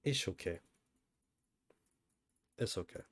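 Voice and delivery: ordinary talk, nothing marked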